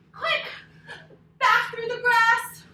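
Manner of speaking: high, loud hurried call; urgent shout. Acted. Story teacher to kids fleeing the bear, urgent. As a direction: urge everyone to flee fast.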